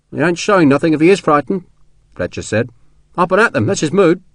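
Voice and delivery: chatty orderly, pitched above the narration; general's orderly. A narrator's reading. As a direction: gossip about your commander as a rank-and-file soldier.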